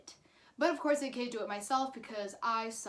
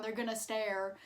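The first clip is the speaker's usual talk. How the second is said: ordinary delivery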